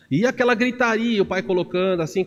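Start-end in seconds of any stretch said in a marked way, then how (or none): none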